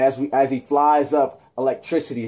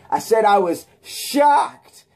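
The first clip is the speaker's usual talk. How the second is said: higher, slow, stretched-out shocked; hammed-up shock; 8 semitones higher, 3 dB louder, much slower than usual. Spider-Man redoing his line with big mock shock. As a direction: overplay the shock, drawing each word out. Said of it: "that wasn't a good one. Sorry, let's do it again".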